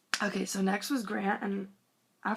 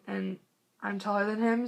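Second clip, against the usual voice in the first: ordinary talk, nothing marked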